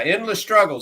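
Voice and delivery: ordinary talk, nothing marked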